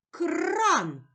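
"Кран" with a long rolled р in the middle; emphasised r. Shown as a demonstration. Teacher helping a child locate the r, emphasised. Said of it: "Де ми чуємо звук р".

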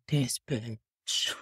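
low, quiet aside; muttered resentment. Retold or imitated. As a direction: mutter it under your breath. Muttered thought about doctor.

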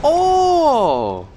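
high loud startled cry; loud surprise. Real, not acted. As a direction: yell out in shock. Sudden game event.

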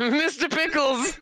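high, drawn-out playful name call; gleeful echo. Pretend. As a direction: stretch the name out high, tickled by it. Echoes host's silly name, gleefully.

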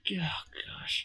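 hushed whisper of awe; a soft whispered gasp. Real, not acted. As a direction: breathe it out in a whisper. Reacting in awe.

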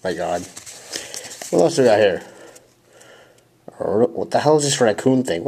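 usual speaking voice

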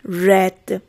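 "Read" with a short e, like "red"; past participle pronunciation. Shown as a demonstration. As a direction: say "Read" to rhyme with "bed".

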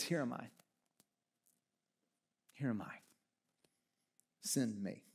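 soft and low, offering yourself; whisper-soft, humbled yes; quiet surrender. Retold or imitated. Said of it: "he says".

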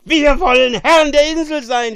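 very loud, high-pitched yelling; drunken shout. A narrator's reading. Drunk monster proclaiming revolt, wild shout. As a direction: bellow it like a drunken rebel. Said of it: "schrie er".

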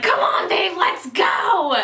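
high-pitched retold shout; urgent calling out. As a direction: call your partner to run now. Retold urgent call to flee.

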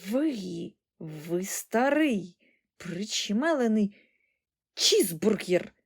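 slow, halting indignant insult; drawn-out furious old-woman voice; fierce granny bark. A narrator's reading. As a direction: spit out the insult word by word. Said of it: "люто гаркнула бабуня".

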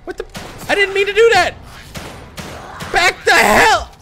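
high, loud, frantic yells; panicked shouting. Real, not acted. Player fumbling controls mid-fight, panicked shouting. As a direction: shout orders to yourself in a panic.

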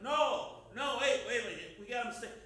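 loud, high, frantic backpedalling; panicked denial. Retold or imitated. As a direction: deny it in a rush of panic, voice up. Peter denies knowing Jesus.

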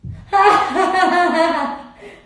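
high loud laugh bursting out; burst of laughter. Real, not acted. Streamer to viewers, cracking up at her own joke, laughing hard.

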